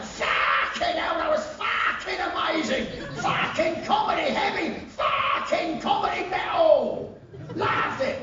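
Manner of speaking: high bellowing Cockney hard-man shout, swearing with glee; comedy Cockney villain. Retold or imitated. Pub villain raving to the band after the gig, high Cockney shout. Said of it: "some comedy Cockney East End villain".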